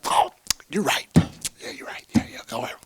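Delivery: breathy, half-whispered acting of a player giving in; imitated easy agreement. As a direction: mimic a player readily taking the criticism. Acting player's compliant reply.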